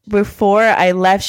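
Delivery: usual speaking voice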